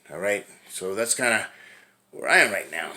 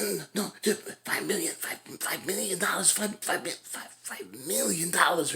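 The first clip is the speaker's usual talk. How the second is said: pleading Vincent; 9 semitones higher, 4 dB quieter than usual. Retold or imitated. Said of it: "And he's like".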